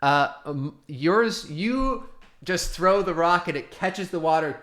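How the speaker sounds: ordinary talk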